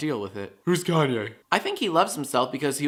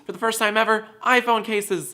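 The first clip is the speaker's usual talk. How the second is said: excited announcement; 7 semitones higher than usual. Acted. Announcing new merch.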